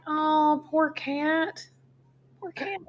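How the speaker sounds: high, soft pitying coo. Retold or imitated. Tourist pitying a creature in the subway, cooing pity. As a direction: coo sadly over a little animal. Voicing pity for supposed animal.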